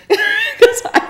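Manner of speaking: high squeal of laughter; laughing. Real, not acted. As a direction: let the words break into laughter. Laughing mid-rant.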